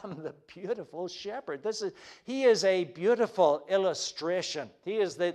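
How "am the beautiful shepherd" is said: soft rendering. Shown as a demonstration. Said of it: "Jesus says".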